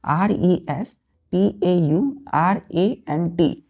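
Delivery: letters spelled out slowly; slow spelling. Shown as a demonstration. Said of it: "restaurant का spelling देखिएगा".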